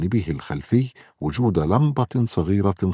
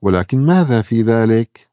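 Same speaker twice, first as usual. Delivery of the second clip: laughing; 6 semitones higher, 8 dB louder than usual. A narrator's reading. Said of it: "يقول مو ضاحكا".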